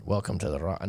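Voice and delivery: half-hearted Scottish accent, softly; attempted Scottish accent. Shown as a demonstration. Host doubtfully trying a movie quote for a viewer, half-hearted Scottish accent. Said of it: "I don't know if I can do the Scottish accent".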